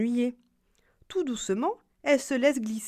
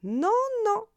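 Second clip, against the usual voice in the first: squeaky little voice; 13 semitones higher than usual. Acted. Galette refuses the bear.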